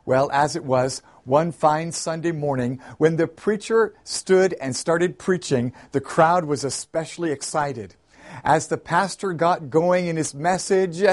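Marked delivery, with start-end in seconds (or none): none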